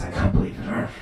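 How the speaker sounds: hushed, disbelieving whisper; muttered inner thought. Acted. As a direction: whisper it privately to yourself. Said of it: "but all they're thinking is".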